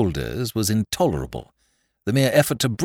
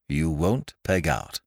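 ordinary delivery

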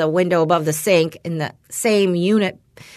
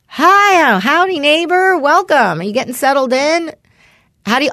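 high, loud, overly friendly greeting, much higher than her own voice; chirpy neighbor; 8 semitones higher, 5 dB louder than usual. Retold or imitated. Retelling how the neighbor first greeted her, high and loud. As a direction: play the eager neighbor calling across the balcony. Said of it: "he was like".

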